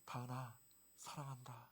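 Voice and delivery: soft unvoiced whisper of love; tender whispered name and love; whisper in the ear. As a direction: breathe it right into her ear. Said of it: "귀에다가 딱 대고".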